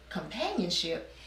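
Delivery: soft questioning echo, pitch swooping up mid-word; puzzled echo. Retold or imitated. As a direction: repeat it wonderingly, as a retold puzzled question. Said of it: "And I was like".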